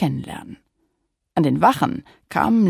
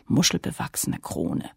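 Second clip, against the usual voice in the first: usual speaking voice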